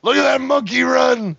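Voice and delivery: hammed-up sportscaster call, yelled high; mock Howard Cosell. Teasing his cohost with a mock announcer shout. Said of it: "Okay, Howard Cosell".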